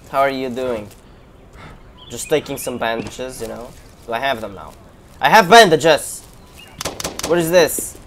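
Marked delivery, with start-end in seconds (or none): 5.2-6.2 loud, slow gleeful cry; triumphant shout. Real, not acted. Player finally finding bandages, loud triumphant shout. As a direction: crow it in triumph.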